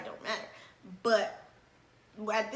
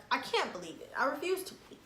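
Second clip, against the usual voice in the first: usual speaking voice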